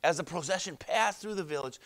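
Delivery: usual speaking voice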